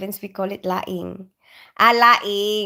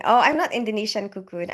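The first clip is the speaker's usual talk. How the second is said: usual speaking voice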